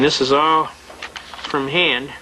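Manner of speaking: normal talking voice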